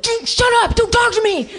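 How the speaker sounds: put-on high, loud bratty boy yelling at his mom; angry boy. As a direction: snap at her like a furious ten-year-old. Voicing the angry troubled boy.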